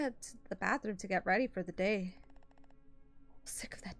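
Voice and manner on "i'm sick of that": whispered. Acted. Reading game text in a whisper.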